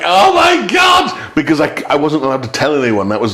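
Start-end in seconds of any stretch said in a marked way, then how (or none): 0.0-1.3 higher, slow drawn-out cry; shocked exclaim. Retold or imitated. Hearing the secret told, a higher, slow exclaim. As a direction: let it out in mock panic. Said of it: "And I'm like".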